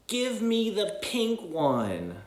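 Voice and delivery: demanding little penguin. Acted. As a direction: drag it out like a spoiled kid. Penguin rudely demands donut.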